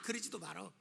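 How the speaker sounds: normal talking voice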